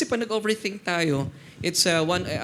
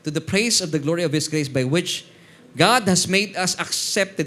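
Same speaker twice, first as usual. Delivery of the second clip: slow, louder solemn reading at the end; scripture reading; 4 dB louder than usual. Acted. Pastor to church, reading Ephesians slowly. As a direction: lean on God and stretch the words. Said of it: "reading scripture aloud".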